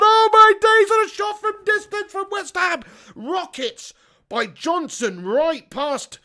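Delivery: high, loud football commentary outburst; excited play-by-play. Real, not acted. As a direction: yell at the near miss, then urge the team on.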